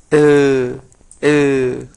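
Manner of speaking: "eh" as a held, loud "eu"; drawn-out "eh" sounding like eu, teeth together; closed "eu" vowel. Shown as a demonstration. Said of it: "se pronuncia como si fuera eu".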